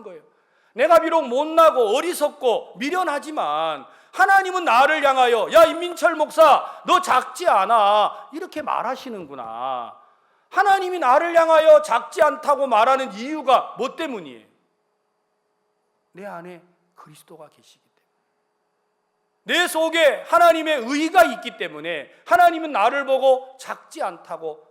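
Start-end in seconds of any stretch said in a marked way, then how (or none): 0.7-8.3 loud, rising preacher's proclamation; fervent preaching. Real, not acted. Pastor preaches to the congregation, loud fervent proclamation. 10.5-14.5 loud, rising preacher's proclamation; fervent preaching. Real, not acted. Pastor preaches to the congregation, loud fervent proclamation. 19.6-24.7 loud, rising preacher's proclamation; fervent preaching. Real, not acted. Pastor preaches to the congregation, loud fervent proclamation.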